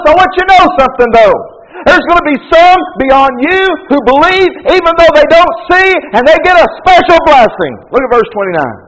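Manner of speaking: high, loud, driving sermon voice; full-force preaching. Acted. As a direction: preach it hard to the congregation.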